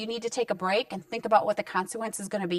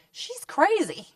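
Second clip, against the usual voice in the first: higher, mocking child voice; kid's eye-roll thought; 9 semitones higher, slower than usual. Mother voicing her child's thought about a yelling mom, mock child voice. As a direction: toss it off like a dismissive kid.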